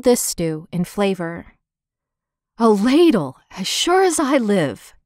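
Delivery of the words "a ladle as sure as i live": slow, breathless wonder; a boy stunned by a homemade ladle; amazed gasp. A narrator's reading. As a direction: marvel at it as if you can't believe it. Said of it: "gasped Henry".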